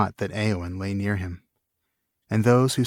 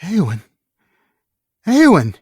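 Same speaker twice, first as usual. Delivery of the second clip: higher, much louder, breaking into a scream of her name; anguished cry; 9 semitones higher, 8 dB louder than usual. Acted. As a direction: shout her name in horror. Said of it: "he cried at last".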